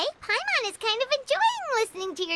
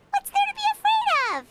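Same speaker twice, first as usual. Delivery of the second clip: incredulous; 12 semitones higher, 4 dB louder than usual. Acted. Paimon teasing about his family.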